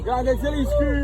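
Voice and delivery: loud, high, slow cheer. Real, not acted. Fan cheering Zielinski as he passes. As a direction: stretch the praise out.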